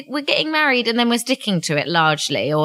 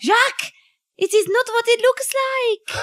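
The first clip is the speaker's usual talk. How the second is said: higher, mock-flustered French wife; French accent; 12 semitones higher than usual. Wife caught with her lover, pleading in a French accent.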